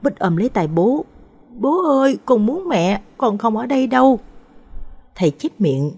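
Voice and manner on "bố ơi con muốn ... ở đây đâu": put-on high, plaintive little boy; high-pitched child voice, acted; small child pleading. A narrator's reading. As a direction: plead with daddy like a scared toddler. Crying child clings to father.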